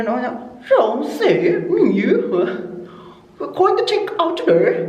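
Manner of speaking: high, swooping mock British accent, swallowing the words; imitated English accent. Vlogger mimicking English guests checking out, amused mock Manchester accent. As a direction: exaggerate a Manchester accent, pitch leaping up. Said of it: "yung accent nyo ba? Kasi parang kinakain nyo na yung words".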